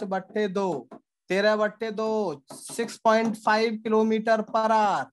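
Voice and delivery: slow, deliberate dictation of the formula; slow teacher read-out. Shown as a demonstration. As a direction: spell out each step slowly for the class. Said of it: "teacher dictates the answer slowly".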